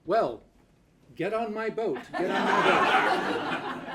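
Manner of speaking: high, drawn-out, laughing invitation; laughing come-on. Acted. Youngest sailor inviting the young woman aboard, laughing croon. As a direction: croon it like a sly sailor luring her aboard. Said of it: "And he says".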